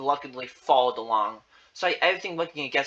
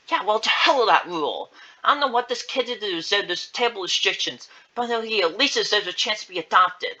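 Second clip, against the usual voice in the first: louder, higher, heated protest; indignant outburst; 7 semitones higher, 5 dB louder than usual. Acted. Beth defending the kid to the caretaker, indignant. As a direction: argue back forcefully on someone's behalf.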